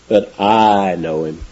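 "I" drawn out long and low; slow, weighty emphasis on "I"; stress on I. Retold or imitated. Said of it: "I" is emphatic".

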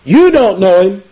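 emphatic, high-pitched "You" in a quoted rebuke; stress on You. Retold or imitated. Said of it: "I" is emphatic".

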